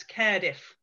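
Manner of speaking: Cardiff with the first vowel as in "care"; local Cardiff pronunciation. Shown as a demonstration. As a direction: pronounce Cardiff as "Kairdiff". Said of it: "pronounce Cardiff as he's written it".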